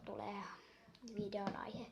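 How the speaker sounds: soft whispered aside; a hushed breathy-free whisper. Real, not acted. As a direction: whisper it as a secret to viewers. Whispered aside about video topic.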